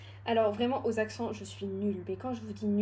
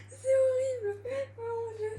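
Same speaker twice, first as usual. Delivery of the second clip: very high, whimpering dismay; real giddy, squealed complaint; dizzy wail; 11 semitones higher than usual. Real, not acted. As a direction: moan it high, reeling from dizziness. Dizzy after spinning, overwhelmed.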